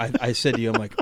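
normal talking voice

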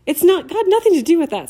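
flustered retort. A narrator's reading. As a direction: snap back, caught out and defensive. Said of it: "her cheeks reddening".

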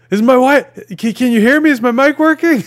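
loud, very high frantic player voice; high-pitched mock-panicked yelling; retold startled shout. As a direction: imitate someone shouting into a dead mic. Retold player suddenly finds voice.

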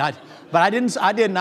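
usual speaking voice

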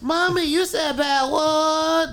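put-on high, drawn-out little kid voice; squeaky singsong kid reproach; scandalized child. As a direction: imitate a tattling child calling out slowly. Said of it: "That's B C A in the background".

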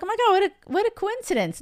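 very high, fast gushing; mock amazement. Coach mocking the idea clients got lucky, high-pitched mock amazement. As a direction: imitate someone marvelling at sheer luck.

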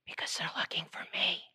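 put-on hushed whisper of a small kid; secretive little whisper. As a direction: whisper it like a child in hiding. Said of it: "Why are you whispering".

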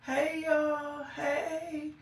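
ordinary delivery

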